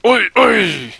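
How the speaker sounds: loud, high heckling shouts; mock yelling "oi". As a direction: yell at her to stop, half joking. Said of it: "And he's like".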